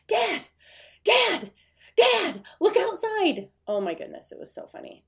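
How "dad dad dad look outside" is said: put-on high, loud child's shout for dad; excited kid calling out. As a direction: yell up the stairs like a thrilled little kid who just saw snow. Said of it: "My junior woke up like".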